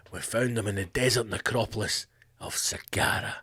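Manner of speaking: low, soft epic movie-trailer delivery of "desert necropolis"; Hollywood trailer voice. Shown as a demonstration. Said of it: "It just sounds Hollywood".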